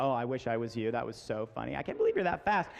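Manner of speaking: low mock adoration; fawning crony girl. Crony girl flattering the lead girl, fawning. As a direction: imitate a breathlessly admiring sidekick. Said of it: "backed up by the crony".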